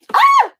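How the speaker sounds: sudden high scream of fright; startled scream. Real, not acted. Host startled by a person entering, sudden shriek. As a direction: jump and shriek.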